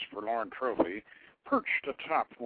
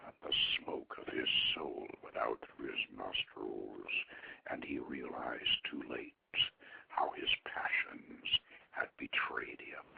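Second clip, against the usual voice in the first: whispered, much lower and softer; dying prince's last moment told in a whisper; hushed whisper; 13 semitones lower, 8 dB quieter than usual. A narrator's reading. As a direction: let the words die away as his life fades.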